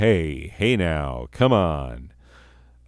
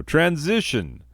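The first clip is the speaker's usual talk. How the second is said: high-pitched transition call; mock announcement; 9 semitones higher than usual. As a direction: sing out the segment change, high. Announcing a slide change in show.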